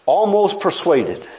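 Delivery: ordinary delivery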